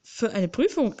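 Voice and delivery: loud, stuttered alarm; taken aback, stumbling on the first word; startled. A narrator's reading. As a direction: blurt it out in fright. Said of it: "Ich erschrak".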